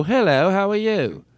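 slow, high put-on South African hello; attempted South African accent. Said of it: "that's the accent I can do".

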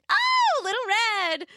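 high, delighted granny cry; a glad old lady greeting her granddaughter; happy exclamation. Acted. Grandmother welcoming Little Red, happily exclaiming. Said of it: "grandmother exclaimed happily".

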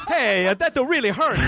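high, wounded cartoon Italian; mock Italian accent. Bullied Italian person protesting, high mock Italian accent. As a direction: play hurt in a high Italian caricature. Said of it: "went up to an Italian person".

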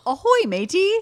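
high laughing pirate greeting; hammed-up pirate with a laugh. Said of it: "I had to".

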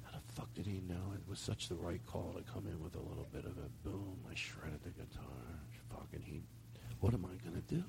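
hushed panic, trailing up at the last question; nervous whisper. Retold or imitated. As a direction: fret under your breath. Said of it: "but he's going".

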